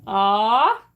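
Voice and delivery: ordinary talk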